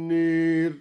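نير with the alif leaning toward i; recited with imala. Shown as a demonstration. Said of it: "بإمالة الألف قبل الراء". (إمالة الألف في نار)

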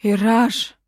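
loud, challenging hail; defiant shout of a name; disrespectful call. A narrator's reading. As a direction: call the ruler out by name without respect. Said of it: "безо всякого уважения обратился".

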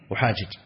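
"أحاجج" said with both jims sounded; unmerged original form. Shown as a demonstration. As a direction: split the double jim in "أحاجج". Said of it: "لأن أصلها أحاجج". ("أحاجج" بفك الإدغام)

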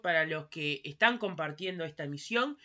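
ordinary talk, nothing marked

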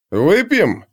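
high, swooping tipsy invitation; lightly voiced drunk barbarian. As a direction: offer a drink like a merry drunk. Drunk barbarian offers a jug.

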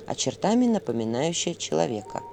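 usual speaking voice

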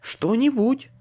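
high, lightly voiced exasperated woman; sighing woman. A narrator's reading. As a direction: sigh it out as a woman. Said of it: "вздохнула женщина".

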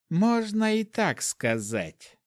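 slow and knowing, half-smiling; mysterious. A narrator's reading. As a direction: drop the words like a riddle. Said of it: "ответила она загадочно".

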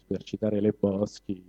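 normal talking voice

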